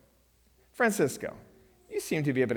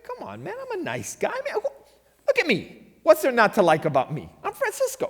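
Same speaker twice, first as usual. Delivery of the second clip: put-on much higher cocky young guy voice; playful Francisco; 14 semitones higher, 5 dB louder than usual. Pastor voicing Francisco brushing off danger, high and cocky. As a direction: sell how likable he is, high. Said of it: "Francisco says".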